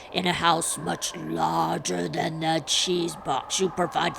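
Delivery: slow, booming spirit voice; ghostly prophecy, drawn out as if into a cup; guttural, horrific voice. A narrator's reading. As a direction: pronounce doom like a guttural ghost. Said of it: "in a guttural and horrific voice".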